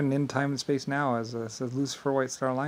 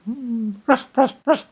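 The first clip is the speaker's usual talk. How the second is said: high, loud playful puppy barks; 11 semitones higher, 7 dB louder, slower than usual. Pretend. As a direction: yap like an excited puppy. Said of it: "like a puppy dog".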